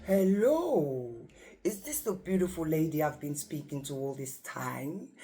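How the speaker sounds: lightly voiced deep-voiced old actor; Christopher Lee. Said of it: "he's got a really deep voice".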